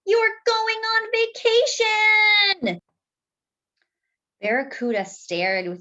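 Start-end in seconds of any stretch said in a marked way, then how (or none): 0.1-2.8 high, slow, thrilled shout; excited children. Acted. Children tell their fish the news, thrilled and high. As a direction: announce the big news with joy. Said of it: "The children rushed home to tell".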